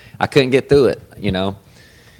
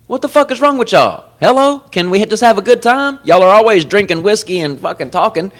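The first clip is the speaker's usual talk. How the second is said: much higher, louder mock outrage; mock exasperated; 11 semitones higher, 3 dB louder than usual. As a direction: yell at the TV in playful disbelief. Mock scolding the show's characters.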